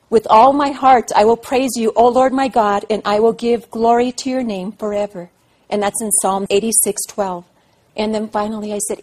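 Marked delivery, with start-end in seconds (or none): 0.1-5.3 proclaiming praise. Acted. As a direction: lift it up like a vow of praise.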